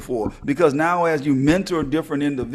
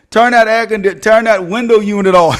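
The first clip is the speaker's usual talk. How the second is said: loud, laughing command; mock bossy order; 3 semitones higher, 6 dB louder than usual. Dorm resident telling someone to switch on the window unit, mock order. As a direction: call it out as a playful order.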